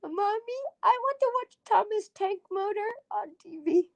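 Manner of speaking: put-on high little kid voice asking for a show; kid begging mommy. Child asking mommy to watch Norwegian Thomas. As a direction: plead like a small child.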